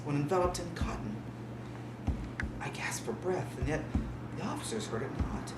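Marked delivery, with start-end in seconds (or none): 2.6-5.5 gasping whisper. Acted.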